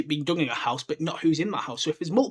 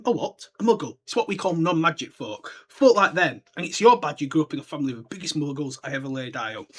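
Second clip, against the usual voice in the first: ordinary delivery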